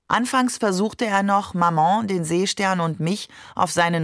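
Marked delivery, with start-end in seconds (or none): none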